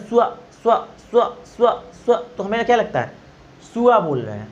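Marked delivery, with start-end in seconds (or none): 0.0-2.2 repeated स्वा, raised and stressed; listen carefully, repeat स्वा; drilling स्वा; careful sva. Shown as a demonstration. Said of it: "ध्यान से".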